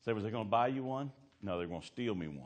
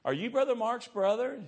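high, loud woman's voice; mock high-pitched female questioner; imitated church woman; 7 dB louder than usual. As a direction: pipe up as a nosy church lady. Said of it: "she goes, she walks up to him and says".

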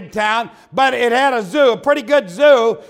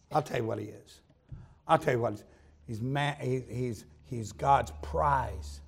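hushed preaching; 9 semitones lower, 10 dB quieter than usual. Acted. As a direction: draw them in with a near-hush. Preacher drops to intimate hush.

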